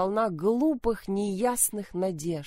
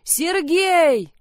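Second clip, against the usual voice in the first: high, loud yell of a name; scream for help; 9 semitones higher, 8 dB louder than usual. Acted. Mother screams for Sergei to come help. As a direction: call out in panic. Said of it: "Закричала".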